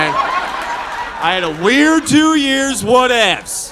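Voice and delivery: loud, slow, drawn-out protest; mock-defensive yell, stretching the words; defensive outburst. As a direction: belt out a hammed-up excuse. Acted defensive outburst to wife.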